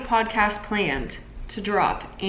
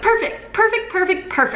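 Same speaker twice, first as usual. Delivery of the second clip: much higher, quick repeated catchphrase; TikTok catchphrase; 12 semitones higher, 4 dB louder than usual. Retold or imitated. Host showing how they say it in YouTube videos, high catchphrase. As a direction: chirp it high like a habitual meme quote. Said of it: "like, say it, like, in my YouTube videos".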